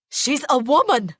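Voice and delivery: high-pitched outburst; screamed protest. A narrator's reading. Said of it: "he screams".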